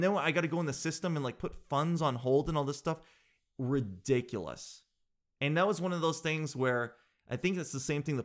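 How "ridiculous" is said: ordinary talk, nothing marked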